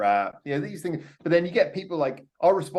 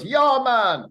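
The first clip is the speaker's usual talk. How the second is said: loud, higher mock Jamaican accent; 8 semitones higher, 6 dB louder than usual. Fan imitating a singer's Jamaican bit, Jamaican accent. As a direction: call it out in a put-on Jamaican accent. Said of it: "there's a Jamaican bit".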